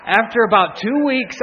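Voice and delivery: usual speaking voice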